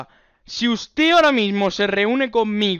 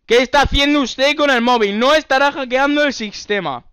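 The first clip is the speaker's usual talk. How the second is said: high, loud indignant questioning; mock accusation; 5 semitones higher, 4 dB louder than usual. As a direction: accuse them with put-on alarm and outrage. Accusing caller of hacking. (acusación indignada de falso policía)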